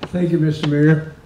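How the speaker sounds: ordinary talk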